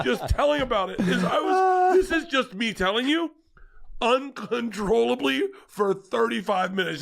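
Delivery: high, real tearful voice; half-laughing while overcome by tears; crying. Real, not acted. Dad moved just telling about the film, crying. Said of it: "I just cried".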